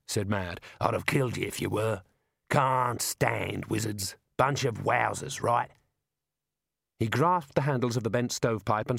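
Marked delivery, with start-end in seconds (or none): 0.8-5.7 slow, drawn-out, disgusted grumble; growing scorn from a tough cart driver; surly driver Mad. Acted.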